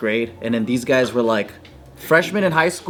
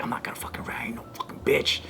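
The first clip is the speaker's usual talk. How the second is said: hushed defiant whisper; 3 semitones lower, 11 dB quieter, faster than usual. Retold or imitated. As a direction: whisper it so the adult can't hear. Secret defiant aside to self.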